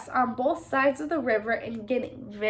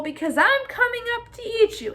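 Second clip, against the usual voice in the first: troll threatens to eat the middle goat; roaring troll threat; 8 semitones higher than usual. A narrator's reading. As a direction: growl the threat upward. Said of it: "roared the troll".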